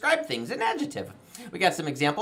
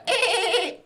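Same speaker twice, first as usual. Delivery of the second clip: high quavering goat bleat; 10 semitones higher than usual. Shown as a demonstration. Host corrects sheep-like attempt, bleating as goat. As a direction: demonstrate a goat's wobbling bleat. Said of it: "A goat's more of a".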